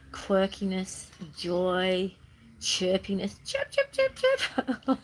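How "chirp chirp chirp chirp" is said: high, chirped bird sounds; sparrow chirps. Shown as a demonstration. Vlogger showing her energy, high chirpy sparrow sounds. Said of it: "like a little sparrow".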